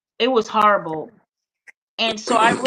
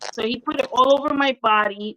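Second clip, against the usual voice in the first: usual speaking voice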